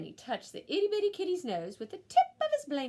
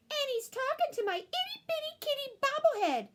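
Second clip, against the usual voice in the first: put-on much higher girl's voice; higher child's voice reporting; little girl voice. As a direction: tattle like a little sister. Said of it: "said Jujubee".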